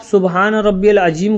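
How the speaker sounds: "अज़ीम" as ajeem, j replacing z; wrong pronunciation. Shown as a demonstration. Teacher warning that prayer is spoiled, "अज़ीम" said as ajeem. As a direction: say "अज़ीम" with a j sound instead of z. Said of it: "आपकी नमाज फासिद हो जाएगी".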